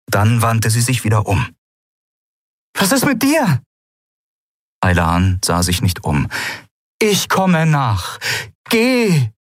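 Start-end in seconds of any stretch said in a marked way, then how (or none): 2.8-3.6 high, anxious question; urgent concern. A narrator's reading. Turning back to the one staying behind, urgent concern. 7.0-9.3 slow, high, emphatic order; insistent. A narrator's reading. Aylan sends Endriel ahead, insistent. Acted order to leave without her.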